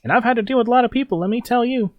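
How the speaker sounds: a sneering boss scolding the locals; smarmy gang leader. Acted. Gang leader taunts the barricade defenders, high theatrical sneer. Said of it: "he's like".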